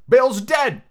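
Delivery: loud, high call from indoors; shouting. A narrator's reading. Pirate calling from the house, shouting. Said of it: "a voice shouted from the house".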